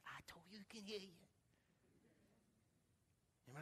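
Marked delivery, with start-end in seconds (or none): 0.1-1.2 very soft, breath-only retelling; hushed daughter. Retold or imitated. Daughter assures pastor her father can hear, whispered.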